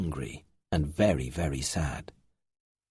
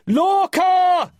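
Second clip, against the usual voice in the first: very loud, high drawn-out shout; desperate shout; 7 dB louder than usual. A narrator's reading. Finn searching for his lost friend, loud desperate shout. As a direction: shout the name as far as it carries. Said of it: "Finn yelled again and again".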